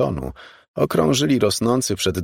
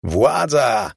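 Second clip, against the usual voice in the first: higher, mildly raised shout; 5 semitones higher, 3 dB louder than usual. A narrator's reading. Jim yelling as he drives, exultant raised shout. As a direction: cry it out like a slogan. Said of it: "krzyczał Jim".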